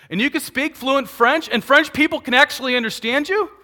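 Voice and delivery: high, loud, incredulous squeak; mock disbelief. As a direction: overplay the amazement.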